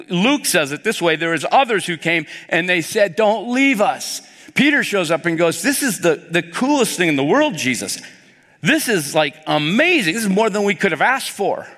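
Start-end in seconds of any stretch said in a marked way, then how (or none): 3.1-4.2 high, slow plea; high, slow cry of don't leave us; pleading. Retold or imitated. Townspeople begging Jesus to stay. 5.6-11.6 high, eager rush of delight; excited gushing. Retold or imitated. Peter gushing to Jesus.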